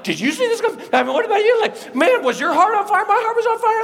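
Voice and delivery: very high, loud, giddy exclaiming; excited astonishment. Acted. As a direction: blurt it out to a friend, high-pitched. Disciples excitedly compare their experience.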